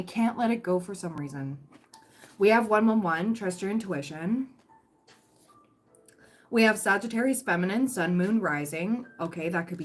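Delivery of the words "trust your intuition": ordinary talk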